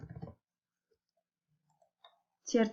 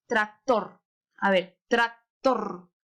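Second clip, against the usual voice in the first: tractor slowly split, weight on a long TOR; stress on last syllable; 2 semitones lower, much slower than usual. Shown as a demonstration. Teacher helping children find the strong syllable, tractor stressed on TOR. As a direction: separate tractor and lean on tor. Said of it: "Mayor fuerza".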